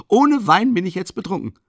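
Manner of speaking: overcome, declare it; emotional exclamation. Acted. Friend tells Rodja he is drunk without wine, exclaiming.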